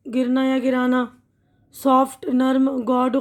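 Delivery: usual speaking voice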